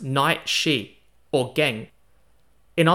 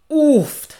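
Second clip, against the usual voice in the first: loud, high wincing oof; pained oof; 12 semitones higher, 9 dB louder than usual. Retold or imitated. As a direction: wince out loud. Reacting to the stabbing story.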